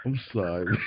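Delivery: usual speaking voice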